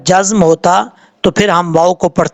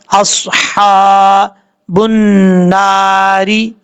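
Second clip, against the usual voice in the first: very slow, drawn-out recitation; slow Quran recitation; much slower than usual. Shown as a demonstration. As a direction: recite the whole phrase slowly as a model. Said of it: "इस तरीके से पढ़ा जाएगा". (धीमी, खिंची हुई तिलावत)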